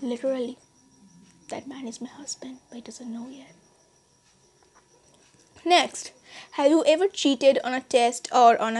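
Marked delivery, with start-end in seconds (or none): 1.5-3.5 hushed, conspiratorial whisper; secret whisper. Real, not acted. Vlogger confessing her crush to subscribers, whispering.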